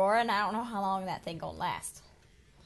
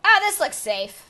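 loud relieved; 8 semitones higher, 13 dB louder, slower than usual. Real, not acted.

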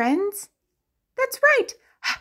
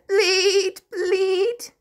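high, bleating goat; 7 semitones higher than usual. Shown as a demonstration. Teacher bleats like a goat for kids.

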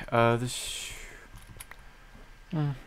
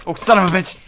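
alarm shout; 9 semitones higher, 5 dB louder, faster than usual. Real, not acted. Attacked from behind.

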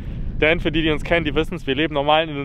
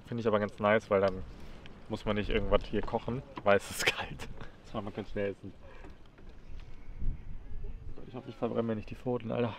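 soft murmur; 2 semitones lower, 12 dB quieter than usual. Real, not acted. Cooking in the cold.

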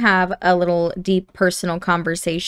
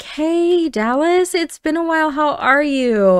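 high, upbeat hello; delighted catching-up voice; cheery greeting; 7 semitones higher than usual. Acted. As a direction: greet a long-unseen relative in a raised, happy pitch. Greeting a relative not seen lately.

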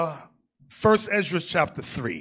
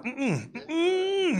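higher whimpered mm-mm; mock whimpering no; 11 semitones higher, 4 dB quieter than usual. Preacher acting out quitting the climb, mock whimper. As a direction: whine the refusal like a beat man. Exhausted refusal.

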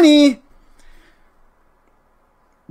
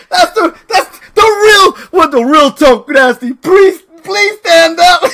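high, broken up by laughter; laughing announcement; 7 semitones higher, 3 dB louder than usual. Real, not acted. Streamer unmasking the fake, cracking up. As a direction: struggle to announce it while laughing.

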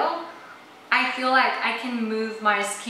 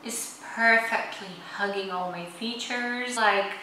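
face stiff under wet mask; through a sheet face mask; 3 dB quieter than usual. Real, not acted. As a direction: lips framed by mask opening. Seen 0: Gold sheet mask covers whole face throughout; mouth opening left free.

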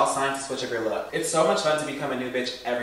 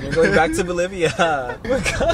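higher, louder, laughing through the words; 4 semitones higher, 5 dB louder than usual. Real, not acted. Teasing a friend about going back to Bolivia, laughing. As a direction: tease through real laughter.

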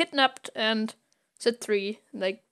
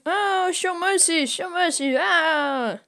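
pleading woman; 8 semitones higher, slower than usual. Retold or imitated. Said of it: "a woman shouting".

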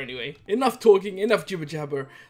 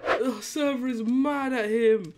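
high, drawn-out, laughing gasps; helpless laughter; 8 semitones higher, slower than usual. Real, not acted. Troll watching the server turn on victim, cracking up. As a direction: squeal it slowly through real hysterics.

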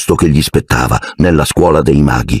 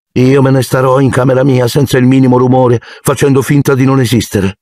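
flat tone; 7 semitones higher than usual. A narrator's reading. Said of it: "ripeté Harry in tono piatto".

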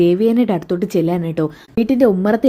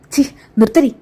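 loud, higher disgusted shout to stop her; angry outburst; 6 semitones higher, 3 dB louder than usual. Acted. Elder sister-in-law silencing Jalaja's taunts, disgusted angry shout. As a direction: slam the door on her words.

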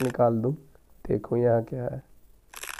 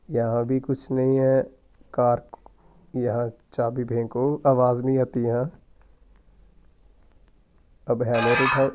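usual speaking voice

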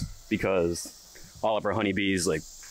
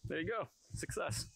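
soft tender voice; 7 semitones higher, 14 dB quieter than usual. Real, not acted. Gently speaking to the bees.

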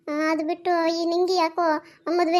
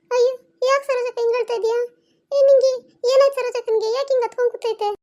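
startled to find her crying; alarmed concern; 5 semitones higher than usual. Acted. Neighbour alarmed at crying woman.

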